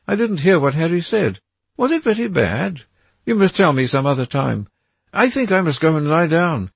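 put-on a nervous young man seeing a threat in the trees; frightened Dorian. A narrator's reading.

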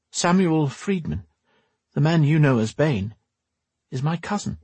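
gentle noblewoman. Acted. Voices Lenora in the story.

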